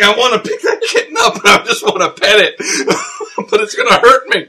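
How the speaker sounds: high, loud comic whine through laughter; laughing mock anguish. Fan comparing the film to a scorpion-tailed kitten, laughing anguish. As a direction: wail it playfully, cracking up.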